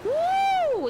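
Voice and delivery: loud thrilled whoop; excited whoop. Real, not acted. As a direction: whoop with joy. Thrilled by new skateboard.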